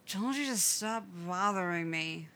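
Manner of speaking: half-slurred, drugged from anesthesia; drowsy slur. A narrator's reading. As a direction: soften the hard sounds, sleepy. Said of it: "voice softening around the hard sounds".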